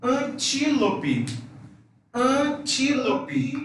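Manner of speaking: antílope stretched slowly, nasal A; antílope very slow, ending in chilopi. Shown as a demonstration. Teacher drawing out antílope for students. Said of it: "na palavra".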